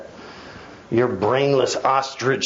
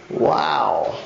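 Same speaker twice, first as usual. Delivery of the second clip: ordinary delivery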